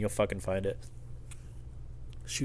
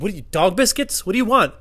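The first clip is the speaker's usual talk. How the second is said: loud, high retold questioning; exasperated bafflement; 7 semitones higher, 7 dB louder than usual. Exasperated clerk questioning customer.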